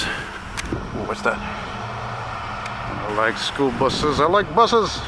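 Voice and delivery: a hint of a goofy kid voice, drawn out; lightly voiced childish glee; silly childlike voice. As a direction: say it like a kid who loves buses. Playful silly voice about buses.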